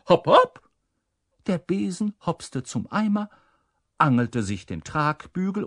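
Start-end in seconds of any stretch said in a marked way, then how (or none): 0.1-0.7 high, loud command; calling out. A narrator's reading. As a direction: call out a brisk order, pitched high. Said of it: "Humboldt deutete auf den Eimer und rief".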